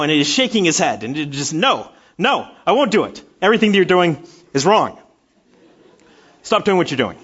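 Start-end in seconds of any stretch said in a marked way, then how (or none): 1.5-7.2 mock stubborn toddler protest, loud; imagined toddler refusing. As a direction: play the defiant child, half-joking. Child voiced refusing, imagined.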